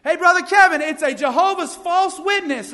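high, loud call down the street; excited kid's shout, imitated; imitated yell. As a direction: yell it as if to someone far away. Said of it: "and he yells out".

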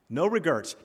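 "regrets" mispronounced as "regerts"; misspelled tattoo wording. Retold or imitated. Preacher voicing Paul to a church, "regrets" said as "regerts". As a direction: turn "regrets" into "re-GURTS". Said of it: "misspelled on his arm".

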